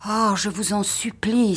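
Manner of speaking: beg, stretching the oh; pleading cry. A narrator's reading. Wife begging her husband not to go back, pleading cry. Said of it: "s'écria-t-elle".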